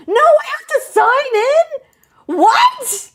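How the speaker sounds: high, drawn-out outraged protest; high-pitched, slow, indignant surprise; dismayed disbelief. Real, not acted. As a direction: wail it in disbelief. Dismay at having to sign in.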